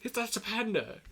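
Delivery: high-pitched incredulous mockery, rising at the end; villain impression. Tai Lung taunting the panda, hammed-up disbelief. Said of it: "he's like".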